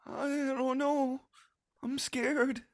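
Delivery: put-on high, slow, trembling child voice; a tiny kid too scared to move. Rabbit child on the branch, high and frightened.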